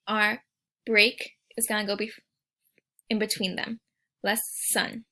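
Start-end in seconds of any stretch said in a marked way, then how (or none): 4.2-5.0 Less sun with the S held in both halves; syllables split. Shown as a demonstration. Teacher showing the S sound in both syllables. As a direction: say Less sun slowly, stretching the S. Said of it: "You can hear the S sound in both".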